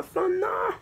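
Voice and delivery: squeaky high no of relief; a sudden high-pitched relieved no. Real, not acted. After the late-goal suspense, a high squeak of relief.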